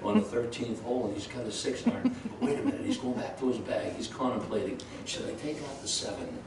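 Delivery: hushed golf announcer with a laugh breaking in; mock the hushed TV golf commentator, very soft; whispering golf commentator. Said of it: "listen to them whisper".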